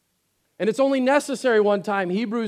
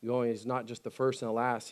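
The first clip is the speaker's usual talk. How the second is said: quiet sincere preaching; 6 semitones lower, 7 dB quieter than usual. Acted. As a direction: draw them in gently, almost confiding. Quiet reflective sermon stretch.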